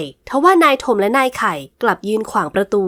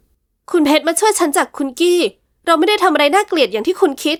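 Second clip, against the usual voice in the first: higher, pleading woman's voice; frightened young woman pleading; 6 semitones higher than usual. Acted. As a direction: beg him to hear her out. Heroine explains herself. (หญิงสาวอ้อนวอนนายหัวด้วยเสียงสูงหวาดกลัว)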